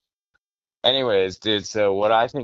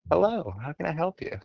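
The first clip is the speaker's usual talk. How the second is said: British Siri voice; 8 semitones higher, 5 dB quieter than usual. Retold or imitated. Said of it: "I had it as a British chick".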